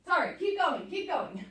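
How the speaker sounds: panting through the words; winded, gasping between words; out of breath. Real, not acted. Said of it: "I can't even talk, I'm out of breath".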